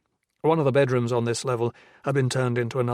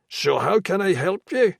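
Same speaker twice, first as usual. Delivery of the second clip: high, loud Irish brogue; Irish brogue, pushy; thick Irish brogue; 7 semitones higher, 5 dB louder than usual. A narrator's reading. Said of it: "in a thick brogue".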